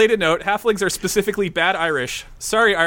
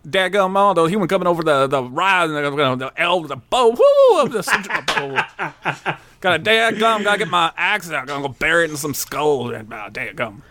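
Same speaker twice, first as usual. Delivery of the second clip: rambling Texan orc; Texan accent. Acted. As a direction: grumble like a backwoods Texan. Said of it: "Orcs are Texan".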